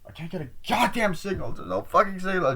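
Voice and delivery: high-pitched mock rant; exasperated whining. Mimicking the film's endless no-signal complaint, exasperated whining. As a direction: whine it high, imitating their frustration.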